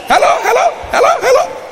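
very high, loud mock hellos; mock phone caller. Mimics people on many phones.

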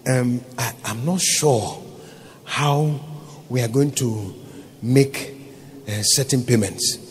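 a nervous accountant, halting and soft; hesitant accountant. Retold or imitated. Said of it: "the accountant came to me and he said".

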